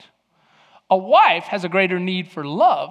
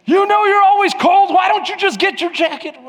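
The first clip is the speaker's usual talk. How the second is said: much higher, louder impatient nagging; mock exasperated nag; 13 semitones higher, 8 dB louder than usual. Husband nagging his wife about her jacket, loud and high.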